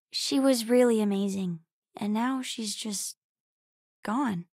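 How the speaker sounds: low, halting sadness; subdued, sorrowful, with heavy pauses; grieving. Acted. Speaks of a lost mother.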